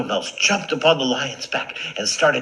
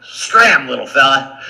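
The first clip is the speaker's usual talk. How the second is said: loud, raised "get lost"; big lion shooing off a mouse; dismissive lion; 3 semitones higher, 8 dB louder than usual. Acted. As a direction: send the little one packing, loudly.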